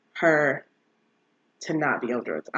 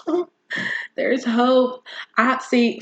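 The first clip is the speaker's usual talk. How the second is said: higher-pitched, pleasantly surprised exclamation; retold burst of hope; hopeful realization; 8 semitones higher, slower than usual. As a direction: gasp it out as hope dawns. Recalling reaction to good news.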